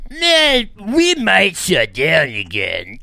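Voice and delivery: high, loud hammed-up caricature; loud put-on voice, far above your own; mocking impression. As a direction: draw it out in a silly squeal, mocking him. Mocking re-enactment of Fauci's hedging.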